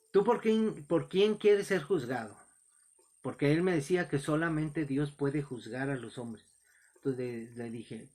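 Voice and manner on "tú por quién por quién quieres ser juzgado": louder, higher confronting question; retold challenge. Preacher challenges the young heckler on the bus, retold forceful question. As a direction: put it to him forcefully, re-enacting the exchange.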